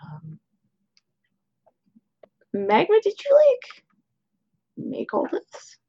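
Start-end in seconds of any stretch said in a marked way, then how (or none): 2.5-5.8 high, wonder-struck question about the food; amazed question. Acted. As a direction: sound dazzled by the spread. Ziva marvels at the dinner.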